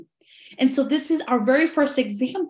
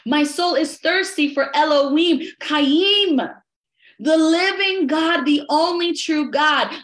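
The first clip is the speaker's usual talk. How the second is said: fervent declaration; 2 semitones higher than usual. Real, not acted. Same line repeated louder.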